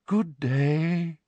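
high, airy dreamy professor; misty, dreamy voice. A narrator's reading. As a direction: let the greeting float out high and dreamily. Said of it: "in her usual misty, dreamy voice".

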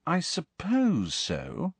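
slow, doubtful drawn out; unconvinced. Acted. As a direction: let the doubt hang on suppose. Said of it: "she sounded unconvinced".

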